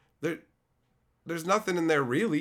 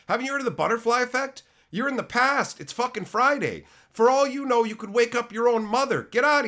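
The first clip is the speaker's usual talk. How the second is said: excited rant; 8 semitones higher, 4 dB louder than usual. Real, not acted. Ranting about time travel.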